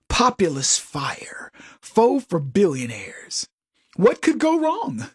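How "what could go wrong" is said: high, sing-song sarcasm; sarcastic. Acted. Host sneers at the Democrats' pick with high sarcasm. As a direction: ask it with mock innocence.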